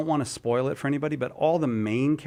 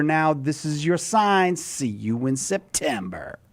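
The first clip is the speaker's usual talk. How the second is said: punchy hype ad, slowing to close; ironic zoomer; 2 semitones higher than usual. Acted. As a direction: pitch it like an influencer sponsor. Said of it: "Cy promoing something in Gen Z slang".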